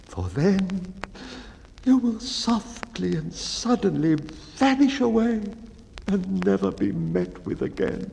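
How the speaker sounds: high, slow, trembling dread; fearful baker. Acted. Baker confessing his dread of the boojum, high and fearful. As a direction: dwell on the horror of vanishing.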